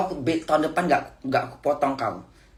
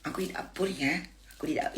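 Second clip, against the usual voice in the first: soft, softer sulky pleading; whiny spoiled plea; 3 semitones higher, 10 dB quieter than usual. Retold or imitated. Mother imitating her clingy plea to the boss. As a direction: coo it like a spoiled child, softly. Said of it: "dengan manja Bunda".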